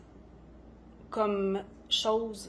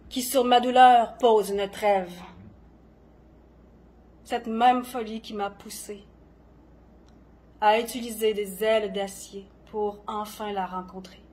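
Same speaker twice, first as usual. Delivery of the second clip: dramatic poetry reading with long pauses; poem recitation; 5 dB louder than usual. Acted. Poet performing own poem on gentle madness, dramatic recitation. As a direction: recite each line deliberately, swelling and falling in intensity.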